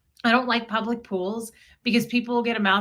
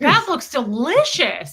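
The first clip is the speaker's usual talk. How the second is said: loud, drawn-out, higher-pitched relish; excited little boy; 4 semitones higher, 6 dB louder, slower than usual. Retold or imitated. Imitating the boy eyeing the cake, excited. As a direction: imitate a child thrilled by food. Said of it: "He was pretty excited about the cake".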